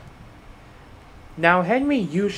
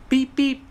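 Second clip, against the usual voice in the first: high, bright little whistle toots; whistle beeps; 11 semitones higher, 4 dB louder than usual. A narrator's reading. Thomas whistling thanks to the waving signalmen. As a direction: toot it like a train whistle. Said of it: "he whistled".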